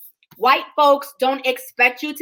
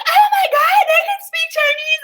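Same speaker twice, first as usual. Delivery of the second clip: much higher, louder squeal of amazement; hammed-up gasp of surprise; mock amazement; 5 dB louder than usual. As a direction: shriek it like a gushing stranger. Mock-shrill amazed woman.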